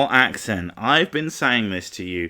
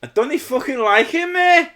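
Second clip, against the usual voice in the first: high, throaty Liverpudlian imitation; Liverpudlian accent; 5 dB louder than usual. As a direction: put on broad Scouse, pitched right up. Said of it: "That's the Liverpoolian".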